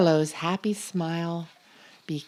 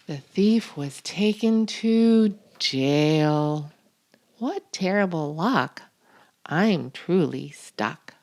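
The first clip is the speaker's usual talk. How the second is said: ordinary delivery, nothing marked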